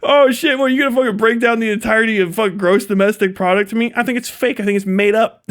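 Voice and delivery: usual speaking voice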